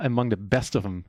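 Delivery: ordinary talk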